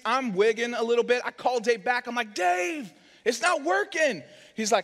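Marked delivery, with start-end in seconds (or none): 2.3-4.2 high, rising panic on the phone; panicked plea. Retold or imitated. Husband calling the fire captain back as it spreads, high panicked plea. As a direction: plead for help, voice pitched up high.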